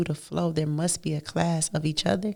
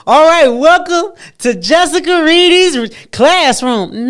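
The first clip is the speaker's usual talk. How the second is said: higher, louder, a hammed-up classroom greeting; mock teacher welcome; 9 semitones higher, 13 dB louder than usual. Jessica mocking a formal class intro, hammed-up teacher voice. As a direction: play a teacher opening class, in jest. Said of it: "This is not some introduction that I'm gonna say".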